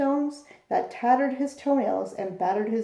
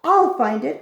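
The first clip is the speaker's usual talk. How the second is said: loud, determined cry; cried out; 3 semitones higher, 7 dB louder than usual. A narrator's reading. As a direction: vow it out loud. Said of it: "cried Horton".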